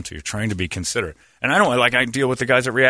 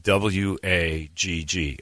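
spelling out; 4 semitones lower, 4 dB quieter, much slower than usual. Retold or imitated. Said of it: "she starts handing it out".

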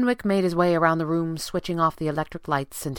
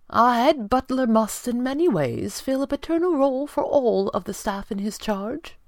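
higher, measured butler voice; dignified butler; 8 semitones higher than usual. A narrator's reading. Head butler justifying himself to his employer, dignified. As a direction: state your duty with formal dignity.

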